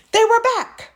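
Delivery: high, loud alarm; horrified storyteller reveal; shocked reveal. A narrator's reading. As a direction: blurt the shock. Spooky reappearance.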